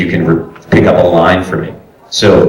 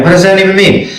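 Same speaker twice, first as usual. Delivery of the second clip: incredulous; 10 semitones higher, faster than usual. Real, not acted. Mocking the odd direction.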